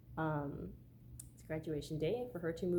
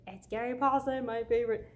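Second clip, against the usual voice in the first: excited fan; 5 semitones higher, 7 dB louder than usual. Retold or imitated. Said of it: "I'm like".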